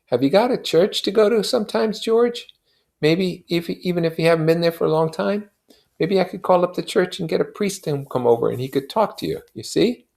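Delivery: high, pleading friend's voice pitched well up; Michaelis's voice. A narrator's reading. Neighbor pressing grieving Wilson for an answer, high and urgent.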